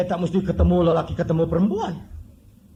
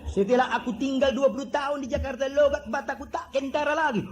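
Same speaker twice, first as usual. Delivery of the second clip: put-on higher, heavy Batak accent, held throughout; thick Batak accent; 7 semitones higher than usual. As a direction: do the Batak man's strong accent straight. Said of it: "Begitu medoknya".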